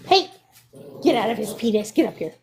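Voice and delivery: ordinary delivery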